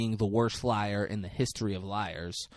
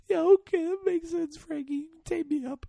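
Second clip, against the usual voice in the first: very high, meek mock whimper; scared compliance. Retells frightened victim giving in.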